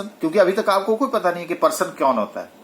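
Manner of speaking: ordinary delivery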